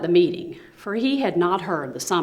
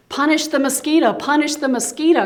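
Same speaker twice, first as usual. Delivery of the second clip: loud, much higher demand; crying out; 8 semitones higher, 5 dB louder than usual. Acted. All the animals demanding punishment, loud high cry. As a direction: call for punishment together. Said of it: "cried all the animals".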